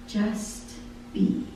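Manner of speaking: breathy whispered refrain. Acted. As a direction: whisper it like a gentle calling. Soft voice of God in lyric.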